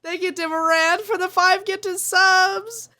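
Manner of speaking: high, loud, happy thank-you; excited thanks. Real, not acted. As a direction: cheer for the gifter. Thanking a gifter.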